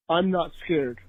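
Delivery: normal talking voice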